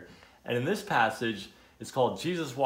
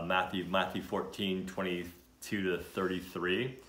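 ordinary delivery, nothing marked

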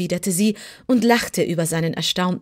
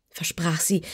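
ordinary delivery

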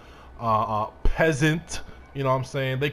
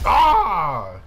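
loud, higher "Ah!"; startled yell; 6 semitones higher, 7 dB louder than usual. Real, not acted. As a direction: cry out in the heat of a fight. Startled while fighting in game.

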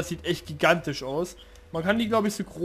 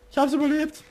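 excited relief; 9 semitones higher, 4 dB louder than usual. Real, not acted.

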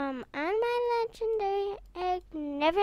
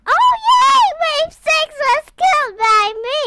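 high, loud victory shout; real high-pitched celebration; excited cheer; 9 semitones higher, 12 dB louder than usual. Real, not acted. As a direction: crow it loudly in triumph. Celebrating a beaten wave.

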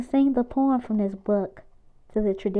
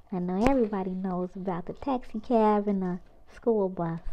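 ordinary delivery

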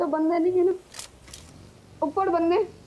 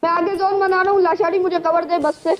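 loud urgent callout; cover me shout; 3 semitones higher, 7 dB louder than usual. Real, not acted. Calling teammate for cover.

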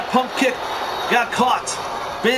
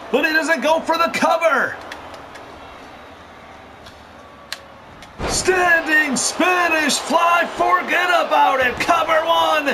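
excited live play-by-play, raised voice; wrestling commentator; 4 semitones higher than usual. Real, not acted. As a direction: call the action as it happens.

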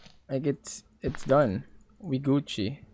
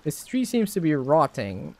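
usual speaking voice

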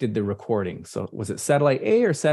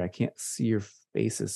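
ordinary talk, nothing marked

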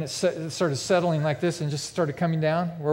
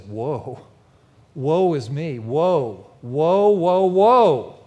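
Isaiah's cry of woe; 6 dB louder, much slower than usual. Acted. Isaiah reacts to seeing God's glory, building lament. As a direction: build each woe louder and more drawn out. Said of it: "What was his first reaction?".

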